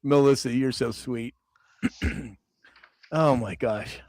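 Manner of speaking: breathless after laughing; catching her breath between words. Real, not acted. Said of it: "I'm out of breath".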